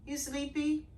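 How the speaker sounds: soft high coo to the dog. Real, not acted. To her sleepy dog, soft high baby talk. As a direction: coo softly to the sleepy pet.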